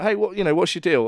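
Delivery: mock-friendly heckler confrontation; calling out hecklers. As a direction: challenge the scowling pair loudly. Confronting scowling hecklers on stage.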